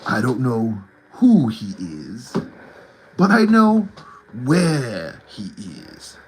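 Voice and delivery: slow, ominous film-villain voice; drawn-out low mystic pronouncement with heavy pauses; King Willie impression. Fan quoting King Willie's warning, slow ominous impression.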